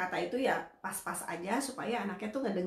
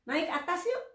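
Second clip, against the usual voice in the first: higher, short inviting phrase; simple coaxing words; 8 semitones higher than usual. Shown as a demonstration. Therapist showing a parent short words, higher and inviting. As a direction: coax in two words. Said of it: "cuma ngomongnya satu dua kata".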